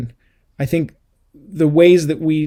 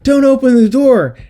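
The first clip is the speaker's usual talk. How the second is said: high, loud imitation of a screaming moviegoer; mock scream; 11 semitones higher, 8 dB louder than usual. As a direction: yell it out like a panicked viewer at the screen. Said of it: "you're screaming".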